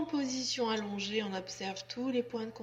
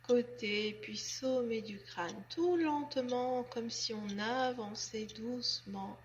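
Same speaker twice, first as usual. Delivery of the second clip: guided relaxation; 5 dB quieter than usual. Acted. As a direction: guide them gently and unhurriedly into letting go. (voix douce et lente de relaxation guidée)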